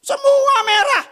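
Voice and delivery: very high, loud, slow shout; punchline shout. Real, not acted. Preacher shouting the joke to the congregation, loud and slow. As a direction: belt out the punchline. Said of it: "punchline shout".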